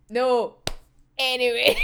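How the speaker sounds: normal talking voice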